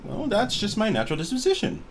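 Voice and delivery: high, perky reply; chipper. Acted. Dying archaeologist explaining her disposition, chipper. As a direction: brush it off cheerily, pitched high. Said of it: "You sound pretty chipper".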